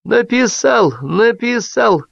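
high, stuck repetition; stretched, looping high cry; like a broken record. A narrator's reading. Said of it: "как испорченная пластинка".

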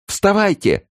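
very high, loud shriek; woman's yell. A narrator's reading. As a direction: scream at him to get up. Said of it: "заорала женщина".

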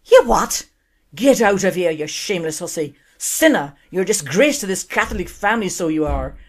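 Irish father throwing his daughter out; furious Irish father. Acted. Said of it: "excuse the accent".

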